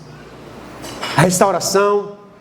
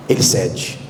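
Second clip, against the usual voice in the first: low emphasis; 14 semitones lower, slower than usual. Shown as a demonstration. Repeated sermon point, low then whispered.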